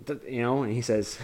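ordinary delivery

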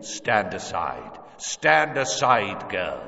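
slow, drawn-out menacing order; menacing command. A narrator's reading. Killer ordering the pleading mother aside, slow menacing command. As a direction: order her aside, slowly and forcefully.

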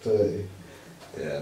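usual speaking voice

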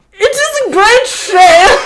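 put-on high, slow whiny old woman. A narrator's reading. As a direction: lament it like a grumpy old queen. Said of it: "she said".

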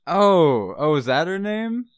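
high, loud put-on surprise; feigned surprise. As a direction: act as if it's news to you. Player bluffs about the client's name.

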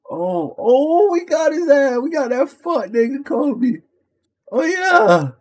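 high, loud celebrating yell; excited shout. Real, not acted. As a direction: hype shout after a big stop. Celebrating a stop in game.

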